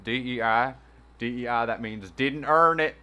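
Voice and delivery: mock a Southern commenter; Southern accent. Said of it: "in this fucking southern ass accent".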